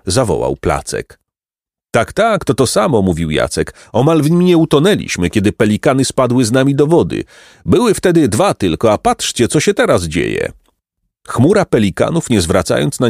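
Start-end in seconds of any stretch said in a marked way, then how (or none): 1.9-3.0 high, quick recognition; lightly voiced lad remembering; eager boy. Jacek recognising the lake, eager. 3.9-10.5 higher, animated recollection; lively boy's voice. Acted. Jacek recalling the pelican fall, animated.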